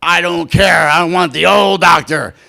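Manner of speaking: put-on old patient rejecting the new doctor, loud and cranky; grumpy old man. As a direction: bark it like a stubborn old patient. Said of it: "And he said".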